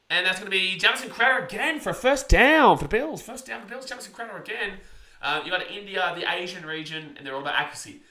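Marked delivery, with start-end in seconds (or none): 0.1-4.7 loud, quick sports call; play-by-play commentary. Acted.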